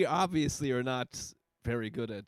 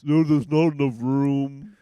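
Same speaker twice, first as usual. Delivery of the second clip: slow, louder mock excuse; mocking imitation; 7 dB louder, slower than usual. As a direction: drag it out to mock the co-host. Mimicking co-host's excuse.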